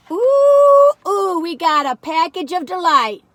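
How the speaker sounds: high, loud delighted ooh; thrilled gasp of joy; excited. Real, not acted. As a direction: squeal with delight at the box. Reacting to a treat box.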